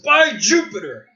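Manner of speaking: loud, high spell shout; battle cry. Acted. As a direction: call out the spell. NPC casting lightning bolt.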